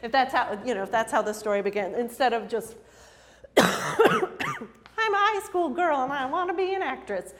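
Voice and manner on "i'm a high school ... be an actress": high, slow, sing-song schoolgirl voice; mock a bland opener like a chirpy teen; dull girly opening. Teller mocks a boring story start in a sing-song schoolgirl voice.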